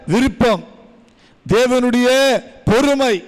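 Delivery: high, loud shouted preaching; fiery preaching. Acted. Preacher crying out to the congregation, shouting. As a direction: hammer each phrase home.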